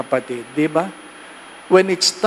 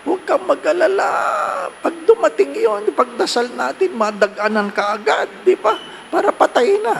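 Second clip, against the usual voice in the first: higher, brisk teasing reassurance; mock comforting joke; 9 semitones higher than usual. Priest joking to a worried parishioner, mock reassuring. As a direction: tease the worrier with fake comfort. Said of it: "Gusto ko sabihin".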